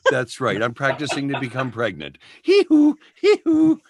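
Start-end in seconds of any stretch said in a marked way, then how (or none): 2.4-3.8 loud, high hee-hoo breathing; hammed-up childbirth breathing noises; pretend birthing breaths. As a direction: puff out mock labour breaths. Joke imitation of childbirth breathing.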